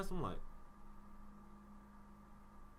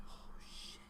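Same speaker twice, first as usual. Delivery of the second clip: hushed, breathy oh shit under the breath; a whispered curse on walking in late; 13 dB quieter than usual. Retold or imitated. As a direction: mutter it so nobody in class hears. Walks into class late.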